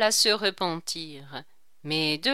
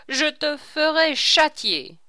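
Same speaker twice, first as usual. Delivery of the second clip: threatening king; 6 semitones higher, 5 dB louder than usual. A narrator's reading. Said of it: "s'écria le roi".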